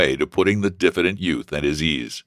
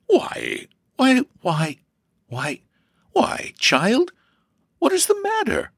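high-pitched surprised mother; halting, wondering questions in a raised pitch; slower than usual. A narrator's reading. Mother to her upset daughter, surprised. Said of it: "raising her eyes in surprise".

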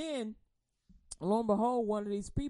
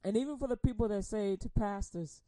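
ordinary talk, nothing marked

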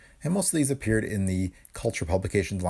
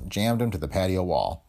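ordinary delivery

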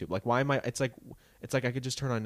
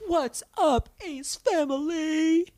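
much higher, louder, drawn-out family vlog greeting; mock vlogger intro; 6 dB louder, much slower than usual. Imitating the Ace Family's channel intro, high stretched vlogger greeting. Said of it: "like a David Dobrik moment".

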